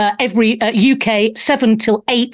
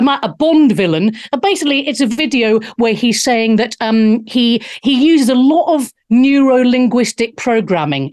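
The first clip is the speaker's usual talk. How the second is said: ordinary talk, nothing marked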